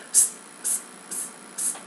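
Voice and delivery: crisp, bouncing unvoiced hisses like rubber balls; bouncy s. Shown as a demonstration. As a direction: bounce each s like a rubber ball. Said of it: "you want them to be bouncing rubber little balls".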